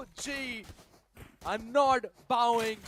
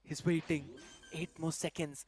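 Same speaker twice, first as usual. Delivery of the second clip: tense hush; 9 semitones lower, 8 dB quieter than usual. Real, not acted. Tense clutch countdown.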